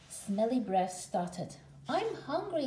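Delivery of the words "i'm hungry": high, moaning complaint of a small cub. A narrator's reading. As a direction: moan it like a bored little brother. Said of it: "he moaned".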